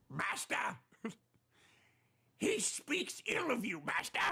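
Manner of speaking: high creepy servant whisper then eager cry; fawning minion voice. Retold or imitated. Streamer voicing his chat as groveling minions, creepy and high. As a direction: play a groveling minion begging to kill. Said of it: "And they say".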